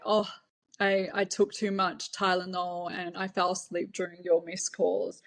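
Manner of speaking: ordinary delivery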